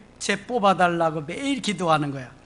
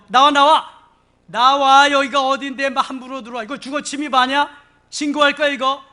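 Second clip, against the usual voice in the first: loud, high orders to get out; re-enacted scolding of trespassers; angry ejection; 8 semitones higher, 6 dB louder than usual. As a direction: order the intruders out forcefully. Orders intruders out, threatens police report.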